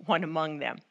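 normal talking voice

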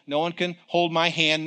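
usual speaking voice

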